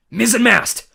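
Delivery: high, loud yell of the word. A narrator's reading. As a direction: shout it out triumphantly. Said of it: "I yelled".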